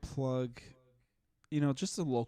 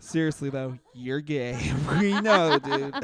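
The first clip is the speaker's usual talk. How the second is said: laughing jab; 8 dB louder than usual. Pretend. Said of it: "laughing jab".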